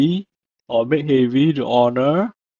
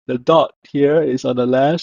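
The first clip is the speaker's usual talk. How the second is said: normal talking voice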